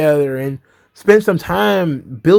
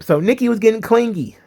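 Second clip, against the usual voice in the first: ordinary talk, nothing marked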